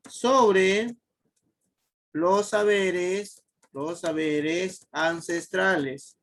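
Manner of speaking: drawn-out, word-by-word dictation; slow dictation. Real, not acted. Teacher writing out the answer for students, slow dictation. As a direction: dictate slowly, stretching the words.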